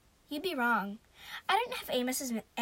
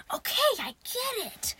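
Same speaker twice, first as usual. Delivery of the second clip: high, drawn-out exasperated yell; exasperated cry, pitched way up; 10 semitones higher, 3 dB louder, slower than usual. A narrator's reading. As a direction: cut them off, fed up. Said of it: "I yelled".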